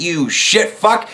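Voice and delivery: normal talking voice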